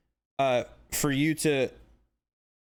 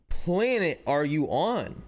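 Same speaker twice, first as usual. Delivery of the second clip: usual speaking voice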